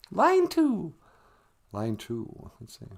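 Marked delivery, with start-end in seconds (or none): 0.1-0.9 high falsetto announcement; high-pitched assistant character. Acted. Assistant figure announces the call.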